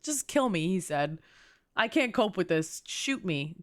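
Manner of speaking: ordinary talk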